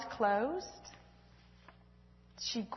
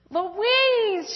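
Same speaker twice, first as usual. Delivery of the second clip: relieved cry; 9 semitones higher, 4 dB louder than usual. Acted. Relieved girl greeting sister.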